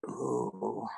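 ordinary talk, nothing marked

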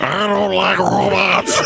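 slow, shouted through laughter; gruff Fred voice. Retold or imitated. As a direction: grumble it like a grumpy mechanic. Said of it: "Fred's like".

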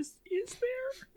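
high squeaky mock whimper; squeaky joke voice. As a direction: squeak it like a cartoon. Playful squeaky joking exchange.

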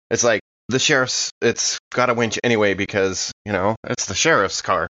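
ordinary talk

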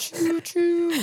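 high, squeaky train whistle; train whistle choo choo. Pretend. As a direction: toot it like a toy train. Mimicking a train sound.

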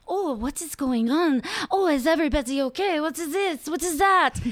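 high, bubbly, clueless voice; ditzy character voice. Retold or imitated. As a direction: play her as a scatterbrained ditz. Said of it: "she's kind of like".

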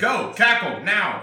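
high, loud barked orders; shouted commands. Pretend. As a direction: yell the order to cackle. Commanding a cackle.

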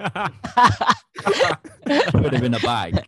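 punchline in a put-on Italian accent. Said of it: "Good, good Italian accent there".

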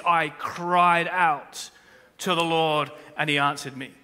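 "cried" drawn out very slowly; stressed quote. Shown as a demonstration. Preacher correcting the verse, stressing cried. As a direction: lean hard on "cried out". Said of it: "It says".

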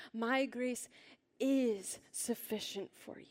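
slow reading with "is" drawn out and emphasized; stress on is. Shown as a demonstration. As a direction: pause before "is", then hit it firmly. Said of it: "this next word is".

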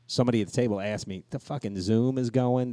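usual speaking voice

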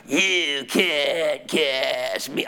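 low, very slow demonic voice. Retold or imitated. Demon speaking through the woman, low and slow. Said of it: "this voice came out of her".

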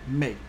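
mai said as meh, short pure vowel; French pronunciation. Shown as a demonstration. Teacher giving learners May in French, mai as short meh. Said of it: "In French".

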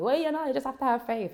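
put-on high-pitched Jamaican accent. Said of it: "picked the Jamaican accent".

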